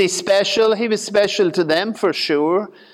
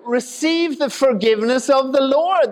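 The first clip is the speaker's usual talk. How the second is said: high-pitched Southern revival preacher; southern accent; 8 semitones higher than usual. Acted. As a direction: call it out in a put-on southern accent. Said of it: "'cause he has a southern accent".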